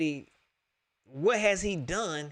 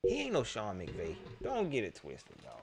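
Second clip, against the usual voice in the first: quiet closing jab; 8 semitones lower, 11 dB quieter than usual. Real, not acted. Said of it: "quiet closing jab".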